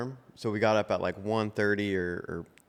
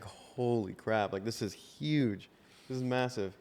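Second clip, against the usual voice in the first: softer, slow, in quiet awe; hushed awe; 7 dB quieter, slower than usual. Retold or imitated. About a friend suddenly famous, hushed slow awe. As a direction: let it drop to a stunned hush.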